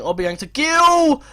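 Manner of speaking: loud, high, slow-stretched name call; excited commentary. Real, not acted. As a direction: draw out the player's name as a shout. Live commentary, pass forward in attack.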